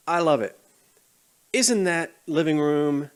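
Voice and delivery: normal talking voice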